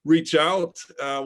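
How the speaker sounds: normal talking voice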